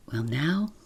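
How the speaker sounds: plain reading voice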